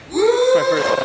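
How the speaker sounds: high falsetto squeak; squeaky voice. Pretend. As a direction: squeak it like a shy kid. Squeaky joke.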